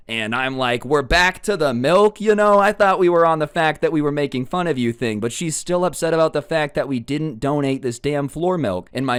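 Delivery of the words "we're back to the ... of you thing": higher and louder exasperated disbelief; incredulous. Retold or imitated. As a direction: throw it back at her, amazed. Incredulous retort to Karen.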